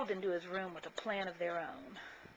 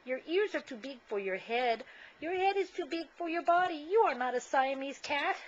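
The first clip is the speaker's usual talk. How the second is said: higher teasing kitty voice; playful kitten; 6 semitones higher than usual. Acted. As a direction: tease the mirror image. Kitten talks to mirror.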